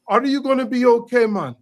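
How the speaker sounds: lightly voiced manager asking a guest if he's okay; retold hotel manager. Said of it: "he literally says to me".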